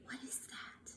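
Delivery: hushed mysterious whisper. Acted. Teacher to class, a suspense whisper. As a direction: whisper it like a secret. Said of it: "asking class in a whisper".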